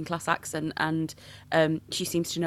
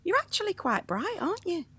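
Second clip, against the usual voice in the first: high, patronising mock producer voice; 13 semitones higher than usual. TV producer surprised she's bright, high patronising mimic. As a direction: talk down sweetly to her. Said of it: "She went".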